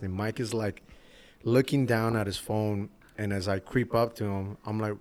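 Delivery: ordinary delivery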